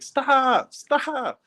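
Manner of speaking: high-pitched drawn-out mock scream; squealed, stretched-out retelling of a scream; imitated mom's screaming. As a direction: imitate a mother screaming at her kids to quit. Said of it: "My mom just started screaming".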